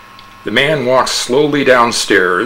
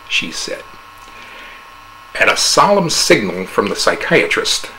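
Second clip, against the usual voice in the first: ordinary delivery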